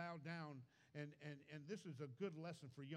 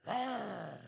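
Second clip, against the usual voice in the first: a sudden loud mock roar of temper, much louder; angry roar; 6 semitones higher, 13 dB louder than usual. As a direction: bark out a furious retold growl, loud and high. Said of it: "And I'd get mad".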